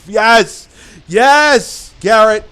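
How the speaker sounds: loud, high, jubilant yes; triumphant cheer. Real, not acted. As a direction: cheer like you won.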